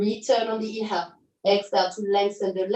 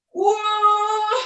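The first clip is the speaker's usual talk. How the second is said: straining whoa; 9 semitones higher, 4 dB louder than usual. Real, not acted. At peak of lift.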